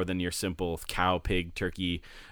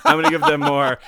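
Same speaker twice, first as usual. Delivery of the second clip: much higher, louder silly voice; voicing a donor, squeaky; 10 dB louder than usual. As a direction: squeal it like an excited fan. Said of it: "You said".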